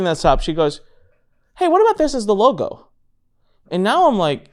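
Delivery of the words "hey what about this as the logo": higher, breezy wife's question; a light hint of the wife's voice; wife's casual question. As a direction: toss off the offer lightly.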